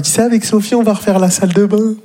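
high-pitched mocking impression; imitating a brother-in-law's small talk; annoying brother-in-law. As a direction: chirp it high as a tiresome in-law. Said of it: "il vient te voir".

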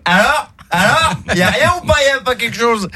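high, eager teasing questions; excited gloating. Acted. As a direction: goad him with giddy excitement. Jumper gloating after coming back up.

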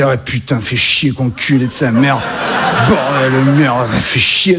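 mock Parisian accent, low and grumbling. Québécois comic mocking a Parisian swearing, low Parisian accent. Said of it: "imiter l'accent français, l'accent parisien".

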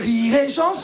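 high, lifted station sign-off; radio jingle. Acted. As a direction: chime it like a station ident. (jingle radio, aigu)

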